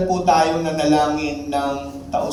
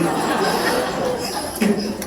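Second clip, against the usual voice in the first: breathy laugh; 5 semitones higher than usual. Real, not acted. Preacher laughs at punchline.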